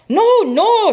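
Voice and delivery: high, loud protest; protesting scientist. Acted. Stickel refusing the president's plan, loud high protest. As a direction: cry out no, no in alarm.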